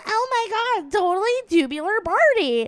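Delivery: put-on high, gushing Valley Girl accent. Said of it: "fifteen going".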